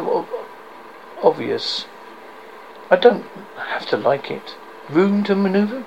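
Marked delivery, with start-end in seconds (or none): 4.9-5.8 sneering question, pitched up. A narrator's reading. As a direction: sneer the words out. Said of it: "Peter sneered".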